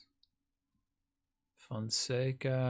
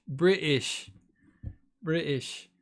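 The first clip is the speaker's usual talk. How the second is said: higher machine-read "British", falling at the end; TTS British voice; 4 semitones higher, slower than usual. Shown as a demonstration. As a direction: copy the robotic TTS saying "British". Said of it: "it sounds like British".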